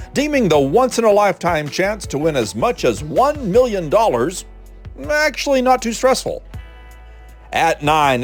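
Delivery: normal talking voice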